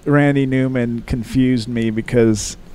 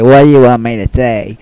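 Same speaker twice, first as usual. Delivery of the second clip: loud cartoon character voice; loud gruff cartoon voice; Brack voice; 12 dB louder than usual. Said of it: "doing Brack".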